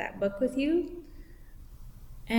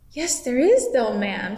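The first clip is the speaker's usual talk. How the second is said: Puddleglum voice; 7 dB louder than usual. Acted. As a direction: insist with a raised, eager voice. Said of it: "said Puddleglum".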